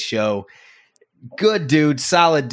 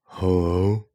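flat, unwilling hello into the phone; glum phone hello; 3 semitones lower than usual. Retold or imitated. Friend mocking his buddy's reluctant phone greeting. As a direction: answer like you don't want the call. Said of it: "that tells me that you're not answering the phone".

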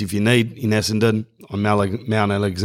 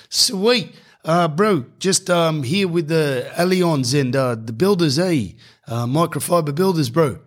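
put-on higher, lightly done Islander accent; 6 semitones higher than usual. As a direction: greet him with a light Islander lilt. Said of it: "He goes".

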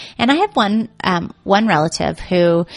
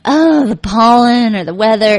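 slow, louder whiny complaint; mock complaint; 3 semitones higher, 6 dB louder than usual. Imitating relative grumbling about pollen, slow and whiny. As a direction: drag out the grumbling lightly. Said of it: "it would be like".

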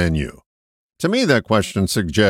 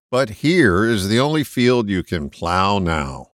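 ordinary delivery

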